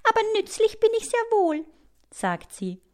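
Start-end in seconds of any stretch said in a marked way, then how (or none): 0.0-1.7 high, amused little spider voice; high-voiced self-satisfied spider; tittering spider. A narrator's reading. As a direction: say it with a titter, pitched up. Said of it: "Die Spinne kichert".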